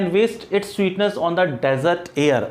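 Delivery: very slow, line read out word by word; teacher reciting a famous line; slow recitation. Shown as a demonstration. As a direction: recite And waste its sweetness on the desert air slowly. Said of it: "और क्या करते हैं".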